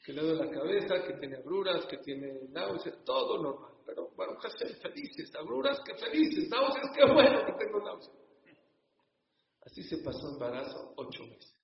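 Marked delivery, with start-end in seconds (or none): 4.4-8.2 laughing with joy. Retold or imitated. Couple happy despite nausea.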